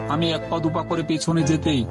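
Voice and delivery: plain reading voice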